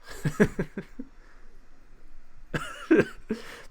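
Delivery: long, high, breathless laughter; real laughing fit. Real, not acted. Streamer finding the whole scene comical, long helpless laugh. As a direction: keep laughing, unable to finish the sentence.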